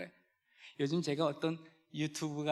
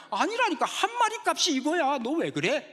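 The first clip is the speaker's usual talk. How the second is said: higher, louder playful retort; cheeky waiter; 9 semitones higher, 5 dB louder than usual. Retold or imitated. Pastor voicing waiter's pun, cheeky insistence.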